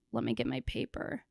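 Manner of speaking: ordinary talk, nothing marked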